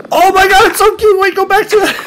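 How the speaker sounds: very high, loud gushing; delighted squeal. Real, not acted. Parent gushing over the cute sleeping dragon, delighted squeal. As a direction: squeal it, overcome by cuteness.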